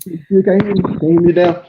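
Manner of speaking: tinny, muffled phone speaker; drive-through speaker sound. Real, not acted. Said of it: "you sound like an Arby's drive-through speaker".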